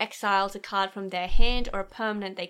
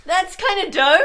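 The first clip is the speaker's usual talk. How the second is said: excited; 9 semitones higher, 9 dB louder than usual. Real, not acted. Both enthused about tontine.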